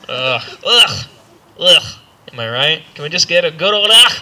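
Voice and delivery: usual speaking voice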